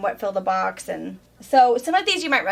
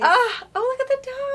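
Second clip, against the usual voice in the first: high-pitched gush over something cute; melting over cute little dogs, way up high; adoring coo; 14 semitones higher than usual. Real, not acted. Gushing over cute fabric print.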